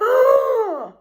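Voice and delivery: high sharp mock gasp of the upset driver; imitated shocked gasp. Bus driver sees her ten bags, loud shocked gasp.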